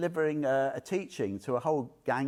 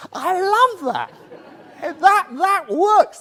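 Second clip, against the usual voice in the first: delighted laughing outburst; 13 dB louder, slower than usual. Real, not acted. Delighted by the sneeze story.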